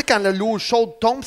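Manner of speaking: normal talking voice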